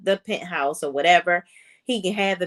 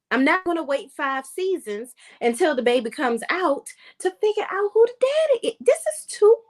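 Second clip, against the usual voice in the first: squeaky, rising frustration; exasperated; 10 semitones higher than usual. Real, not acted. Vlogger fed up with Tyler Perry's baby plot, high and exasperated. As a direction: let the pitch climb as you lose patience.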